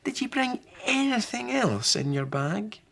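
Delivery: put-on high, curious boy voice; a child fishing for a surprise; high voice, eager question; young boy Harry. Harry asks Granny.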